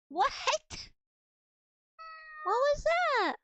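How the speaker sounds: high, loud startled question. Real, not acted. Streamer startled by her cat's sound, loud and high. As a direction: jump at the cat's sudden noise.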